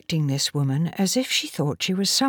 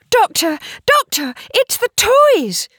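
put-on high, loud child's cry of discovery; excited child calling out; 6 dB louder than usual. A narrator's reading. Polly runs to the doctor with news, excited. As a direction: call out urgently in a high little-girl voice.

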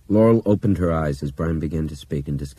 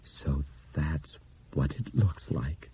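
softer, slow hushed awe; soft awed voice; 6 dB quieter, slower than usual. Acted. Bob gazing out cockpit window, soft awed voice. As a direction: breathe it in wonder. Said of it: "in a soft, awed voice".

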